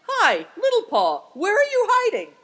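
very loud, high calling shout; thundering. A narrator's reading. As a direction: holler for someone who is hiding. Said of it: "he thundered".

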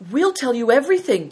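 put-on high, loud boyish declaration; eager declaration. A narrator's reading. Boy promises the lama everything, declaring it loudly. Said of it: "declared Big Tiger".

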